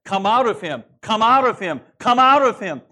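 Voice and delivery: rising exorcism command; commanding shout building. Retold or imitated. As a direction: drive the demon out, rising to a roar. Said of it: "walking toward him going".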